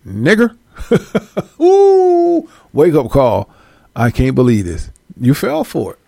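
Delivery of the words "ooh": high, loud, laughing ooh. Acted. Reacting to the blow in the skit, a high laughing ooh. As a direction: wince with glee at the hit.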